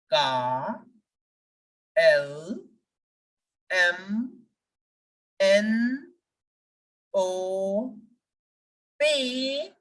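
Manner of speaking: letters given French names, "A" as "ah", "B" as "bay", one by one; drawn-out French letter names with long pauses between; French alphabet recital. Shown as a demonstration. Teacher asking learners to repeat after them, slow, spaced. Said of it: "Please repeat after me".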